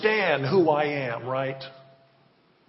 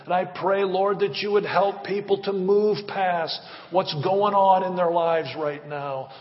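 louder pleading pastor's prayer; fervent prayer; 6 dB louder than usual. Real, not acted. Pastor praying aloud for children and marriages, fervent. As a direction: pray over the church with rising fervor.

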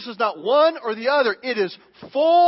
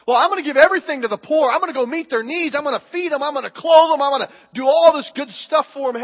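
higher, louder, quick eager voice; eager do-gooder; 5 semitones higher, 5 dB louder, faster than usual. Retold or imitated. An overeager giver promising the poor everything, excited. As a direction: gush about all the good you'll do.

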